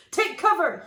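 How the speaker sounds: hammed-up storybook yell. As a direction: shout the warning. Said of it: "he yelled".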